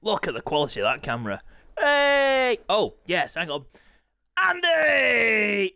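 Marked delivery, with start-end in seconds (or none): 1.8-2.6 high, loud, drawn-out hey; calling out. Real, not acted. Commentator greeting someone on stream, loud high call. 4.4-5.7 long, high, loud holler; calling out. Real, not acted. Commentator hailing Andy, drawn-out high shout.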